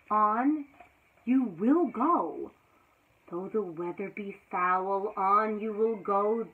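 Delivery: ordinary delivery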